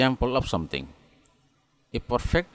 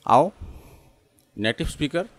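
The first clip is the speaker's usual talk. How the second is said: normal talking voice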